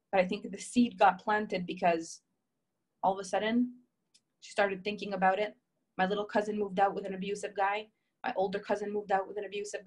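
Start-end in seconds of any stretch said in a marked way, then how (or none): none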